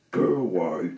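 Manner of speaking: put-on grumpy old man dismissal; rude. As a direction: snap at the pest to leave. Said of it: "said Mister Appleton rudely".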